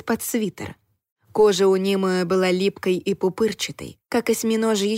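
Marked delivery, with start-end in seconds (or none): none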